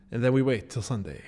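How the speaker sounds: usual speaking voice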